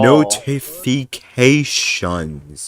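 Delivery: high, loud, very slow mock-showman voice; grandiose sign-off. Streamer echoing the friend's prompt, hammed-up grand voice. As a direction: drag out every syllable grandly.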